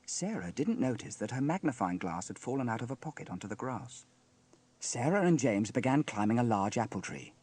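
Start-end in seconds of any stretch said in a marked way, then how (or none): none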